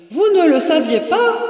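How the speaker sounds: high, loud question; calling down. A narrator's reading. Mistress asks woman below, calling down. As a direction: toss the question down the stairs. Voice from upstairs answers.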